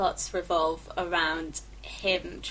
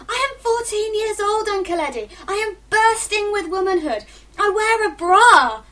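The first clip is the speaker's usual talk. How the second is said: put-on high, loud teenage outburst; indignant shout in a high voice; indignant yelling; 12 semitones higher, 7 dB louder than usual. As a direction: yell it at an uncle, building to the last words. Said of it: "I feel like yelling at him".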